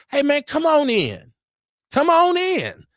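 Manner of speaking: mock a too-friendly open-door welcome; overly welcoming host.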